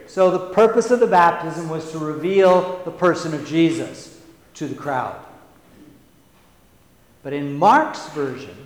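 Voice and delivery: ordinary talk, nothing marked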